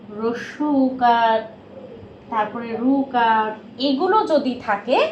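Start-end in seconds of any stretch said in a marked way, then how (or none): 0.1-3.5 slow, deliberate listing of vowel signs; name each sign slowly for young pupils; teacher spelling it out slowly; slow careful naming. Shown as a demonstration. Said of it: "teacher naming vowel signs slowly".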